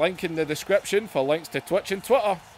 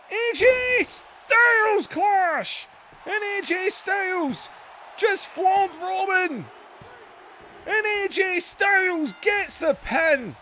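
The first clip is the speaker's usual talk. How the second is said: excited ringside commentator calling the action; hyped pro wrestling commentary, bursts of shouting on big moves; wrestling play-by-play; 3 semitones higher than usual. Acted. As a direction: call every move like a live TV announcer. Live wrestling game play-by-play.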